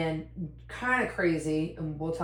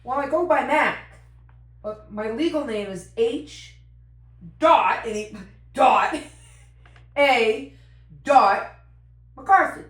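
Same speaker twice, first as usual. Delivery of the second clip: imitating Mac; 6 semitones higher, 9 dB louder than usual. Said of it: "And he goes".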